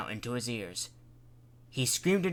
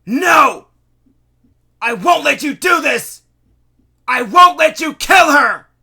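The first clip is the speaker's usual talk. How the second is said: very high, very loud desperate scream; shout; 21 dB louder than usual. A narrator's reading. Mayor screaming at the Cat Queen to spare his wife. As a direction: scream it to save her.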